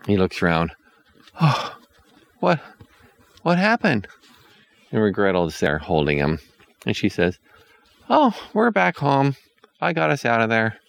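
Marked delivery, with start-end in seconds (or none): 1.4-4.1 put-on groggy boy. A narrator's reading. Hansel wakes up confused. 8.1-10.8 put-on girl's voice. A narrator's reading. Regrettal comforts Hansel.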